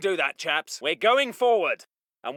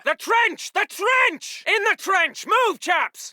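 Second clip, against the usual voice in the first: high, punched-out shout; urgent shouted order; 8 semitones higher, slower than usual. Acted. Officer sending chaps into the trench, shouting urgently. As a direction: yell them into the trench.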